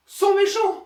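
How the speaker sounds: put-on high, loud whiny child voice; mock the kids' logic in a squeaky pout; childish mock voice. Reviewer mocks the toys' reason, high childish whine.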